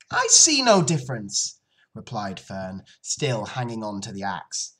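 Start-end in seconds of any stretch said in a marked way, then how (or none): 0.1-1.6 higher, louder flat refusal; defiant child. Acted. Fern hangs on to the axe, loud defiance. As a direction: stand firm as a stubborn daughter.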